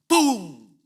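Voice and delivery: loud punch noise. Retold or imitated. Retelling a punch in a fight.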